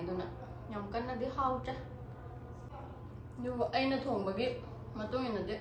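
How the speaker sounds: talking with a mouthful of food; quiet, chewing between words; chewing while talking. Real, not acted. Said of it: "ꯉꯁꯥꯏꯒ ꯆꯥꯕ ꯍꯧꯔꯒ ꯋꯥꯒ ꯉꯥꯡꯉꯒ".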